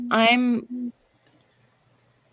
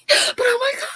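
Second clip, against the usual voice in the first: squealing excitement. Retold or imitated. Retold excitement.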